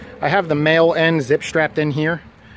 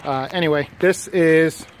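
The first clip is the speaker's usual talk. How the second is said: ordinary delivery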